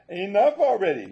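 high, loud exasperated snap; an older dog losing patience; fed-up little dog. Acted. Dog Love voiced, annoyed.

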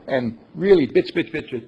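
usual speaking voice

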